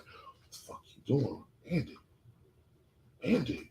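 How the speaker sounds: hushed, goading undertone; voice in his head. Retold or imitated. Voice in his head taunting him during counseling, hushed. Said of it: "that's all I'm hearing".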